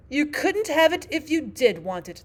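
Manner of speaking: loud, high-pitched Queen; imperious Queen. Acted. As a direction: declare it like a storybook Queen.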